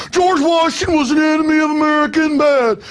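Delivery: high, put-on country boy accent. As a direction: imitate a police lecturer with a hammed-up country boy accent. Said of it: "I'm doing a country boy accent".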